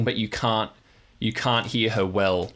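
ordinary talk, nothing marked

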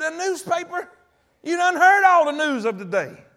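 high, disbelieving question building to a loud protest; incredulous retort. Retold or imitated. Tired driver answers his friend late at night, loudly incredulous. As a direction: exclaim in acted-out disbelief. Acts out his baffled reply.

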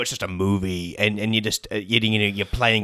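Thanos. Acted. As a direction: put on a big low Mad Titan voice. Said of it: "interview with Robert Downey Junior and Thanos".

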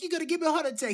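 pitched high, exasperated; mock son complaining to mom; mock Nikki. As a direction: imitate Nikki whining to his mother. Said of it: "he was like".

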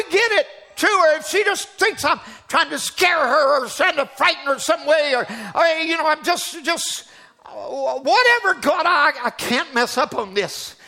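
anguished plea. Retold or imitated. Preacher reliving his panicked prayer, pleading. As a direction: beg God not to let you fail.

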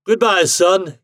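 high, loud farewell call. A narrator's reading. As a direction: call it from a departing cab window. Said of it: "he called".

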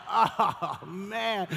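low groan with a laugh in it; acted dismay. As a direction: sag into it, half laughing in dread. Acted dismay at surprise run.

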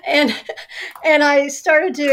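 laughing as she speaks. Real, not acted. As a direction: laugh through the words.

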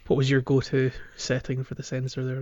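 normal talking voice

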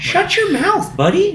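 very high-pitched mock indignation; mock retort. Retelling a retort about the hose, high mock indignation. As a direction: send it way up high, imitating a fed-up officer.

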